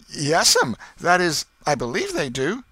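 put-on high, loud hedging boy's voice; nervous boy. A narrator's reading. Apprehensive boy answers his aunt, high and hedging. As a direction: backpedal like a worried kid.

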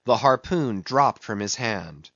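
ordinary delivery, nothing marked

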